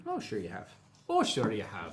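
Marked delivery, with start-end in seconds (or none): 1.1-1.9 joking, put-on Irish lilt; mock Irish accent for a moment. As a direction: say it in a playful Irish brogue. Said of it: "You just became Irish for a little bit".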